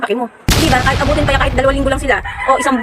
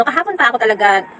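ordinary delivery

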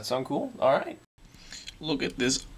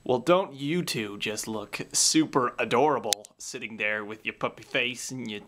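normal talking voice

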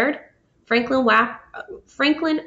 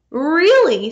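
eager really; 5 semitones higher, 6 dB louder than usual. Acted. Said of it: "said Franklin".

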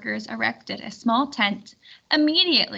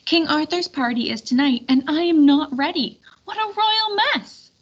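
flustered; 5 semitones higher, 5 dB louder than usual. Acted. Lady Di flustered before party.

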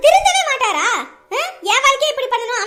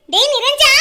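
calling out; 7 dB louder, slower than usual. Acted. Calling brother again.

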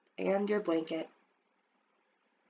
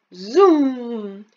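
loud airplane zoom; kid's zooming sound effect; playful zoom; 2 semitones higher, 9 dB louder than usual. Acted. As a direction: make the plane noise with gusto. Child playing airplane.

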